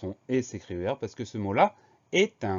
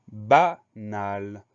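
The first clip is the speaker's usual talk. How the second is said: BANAL slow and split, ba... nal; syllable by syllable; 6 dB louder than usual. Shown as a demonstration. Teacher introduces the next dictation word, syllable by syllable. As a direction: stretch banal into two separate, emphatic syllables.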